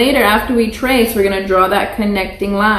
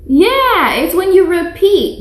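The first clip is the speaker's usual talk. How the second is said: excited praise; 8 semitones higher, 3 dB louder than usual. Real, not acted. Praising a child's answer.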